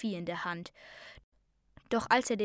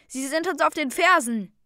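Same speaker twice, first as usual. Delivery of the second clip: higher, louder alarmed call; urgent warning; 6 semitones higher, 7 dB louder than usual. A narrator's reading. Hero warning shipmates of pursuers, urgent raised voice. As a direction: warn the crew of pursuit.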